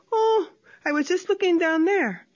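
high, faux-innocent voice; high faux-innocent opossum. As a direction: play innocent, light and high. Tlacuache innocent.

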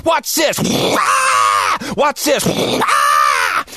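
wild yelling. Retold or imitated. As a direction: holler like a kid at a water park. Boys playing in restroom.

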